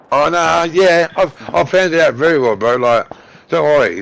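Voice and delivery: ordinary delivery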